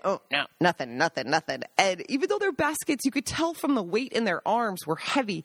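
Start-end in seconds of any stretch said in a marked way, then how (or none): 0.0-1.6 low, evasive denial; secretive hunter. Retold or imitated. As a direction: brush them off, giving nothing away.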